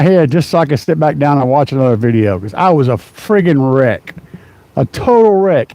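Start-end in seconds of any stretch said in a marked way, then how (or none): none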